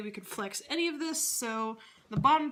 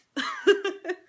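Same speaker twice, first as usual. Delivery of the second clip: burst of laughter; 9 semitones higher, 7 dB louder than usual. Real, not acted. Laughs at feet joke.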